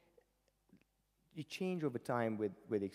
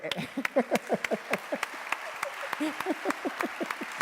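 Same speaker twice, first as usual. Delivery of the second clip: long breathless high laugh; helpless high-pitched laughing fit; laughter; 8 dB louder than usual. Real, not acted. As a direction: burst out laughing at yourself. Laughs at his own useless talent.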